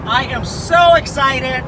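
slow, loud drawn-out excitement; mock excited. Friend asked about the pier, drawn-out mock excitement. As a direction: stretch it out, hammed-up. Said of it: "Are you excited?".

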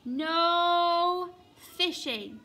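long, loud drawn-out NO; bossy proclamation. A narrator's reading. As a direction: boom out the rule like an official order. Duck's new sign read out.

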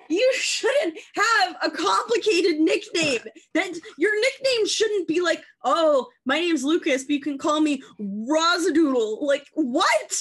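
high, loud ranting disbelief; comic outraged rant. Real, not acted. Ranting to co-host Lucas about silly nicknames, outraged. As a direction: hammer it out loud and high, incredulous.

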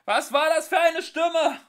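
high, loud frightened yell; shouting. Acted. Arthur shouting to Ford over the din, loud. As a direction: scream the question over the noise. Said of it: "schrie Arthur".

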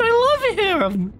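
high, slow adoring squeal; overwhelmed by cuteness. Real, not acted. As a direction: gush with love. Gushing over creature.

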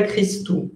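"Christou" with CH said as a hard k; CH as plain C. Shown as a demonstration. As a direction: say the "Ch" of "Christou" like a single C. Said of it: "es una C sola". ("Christou" con la CH pronunciada como una C sola)

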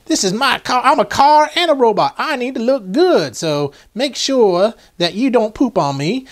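playful put-on Southern gentleman voice; high Southern character voice, easing down toward the end; Colonel Sanders. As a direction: ham up a Southern accent for the toy. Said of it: "like, a Colonel Sanders Southern".

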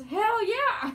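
mock the viewer's gleeful answer; hammed-up enthusiastic yes; imitating an eager yes. Blind viewer's enthusiastic reply.

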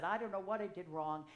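ordinary talk, nothing marked

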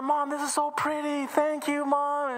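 put-on high, thrilled little girl; delighted child. Emily thanks Mom at the mirror, high and delighted. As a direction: gush with joy over the dress.

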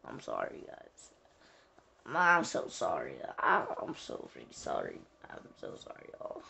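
hushed, breathy sorry, barely voiced; real sheepish whisper, mostly unvoiced; whispered apology. Real, not acted. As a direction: whisper your apologies to the viewers. Game misfire, whispered apologies.

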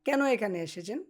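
normal talking voice

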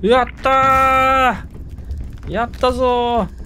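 high, loud drawn-out cheer; triumphant cheer. Real, not acted. Player escaping the ants with the bag, triumphant cheer. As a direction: shout out the win.

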